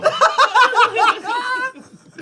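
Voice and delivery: shrieking burst of laughter; loud high laugh. Real, not acted. Big laugh.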